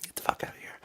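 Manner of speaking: hushed mock exasperation; quiet muttered whisper; whispered aside. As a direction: whisper it under your breath.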